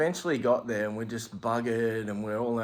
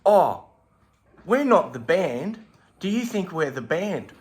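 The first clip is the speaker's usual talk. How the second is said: mock clueless mate; 6 semitones higher, 4 dB louder than usual. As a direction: blurt it out innocently. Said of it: "and he goes".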